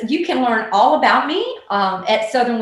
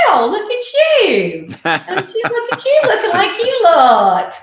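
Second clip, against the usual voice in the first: bright sing-song fake praise, higher and louder with a laugh; sugary mock compliment; 10 semitones higher, 5 dB louder than usual. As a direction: sweetly insult her outfit with a smile you can hear. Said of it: "you might say".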